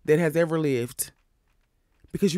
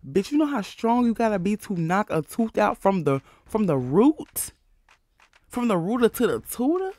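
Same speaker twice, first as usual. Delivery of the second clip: rising pitch, disbelieving build to "tutor?"; incredulous; 5 semitones higher than usual. Real, not acted. Mocking Tasiki's strength to her viewers, rising in disbelief. Outraged rhetorical build to punchline.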